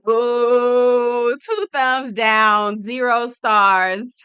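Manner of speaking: loud, slow final verdict; booming mock rating. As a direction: drag it out like a judge's sentence. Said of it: "final verdict".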